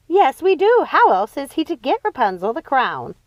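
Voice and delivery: eager witch sister, voiced up high; Martha's voice.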